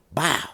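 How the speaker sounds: high, sudden crash noise; imitated impact. Storyteller mimics hitting the street sign, high impact noise. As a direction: pop it out like a hit.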